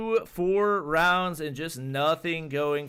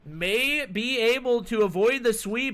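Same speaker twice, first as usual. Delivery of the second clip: high, loud hype call; esports caster; 8 semitones higher, 6 dB louder than usual. Acted. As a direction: yell the follow-up hit. Live play-by-play peak.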